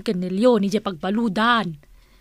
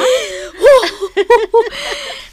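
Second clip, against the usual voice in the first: high, loud, giddy cackle; a coy, giddy burst of laughter; 11 semitones higher, 6 dB louder than usual. Acted. [name] laughing giddily about getting married.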